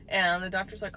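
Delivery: ordinary talk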